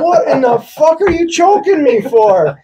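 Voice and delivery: high-pitched indignant demand; incredulous mock fury; mad, acted out. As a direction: imitate an outraged friend who was just choked. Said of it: "they were so mad".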